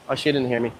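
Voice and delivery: usual speaking voice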